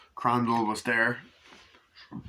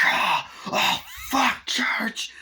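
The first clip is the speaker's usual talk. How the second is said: high, loud burst of swearing; mock startled yell; 4 dB louder, much slower than usual. Man startled by his cat, high mock yell. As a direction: act out a jump scare from a cat.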